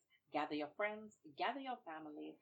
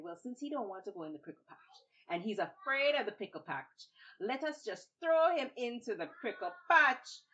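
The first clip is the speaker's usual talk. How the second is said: crowd deciding; 5 semitones higher, 8 dB louder than usual. Acted. Townspeople decide.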